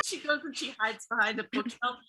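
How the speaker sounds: ordinary talk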